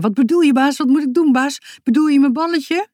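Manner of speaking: high, excited dog voice; breathless-eager little dog character. Acted. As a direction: pester the boss with keen questions. Eager dog answering its master.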